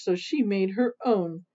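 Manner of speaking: ordinary delivery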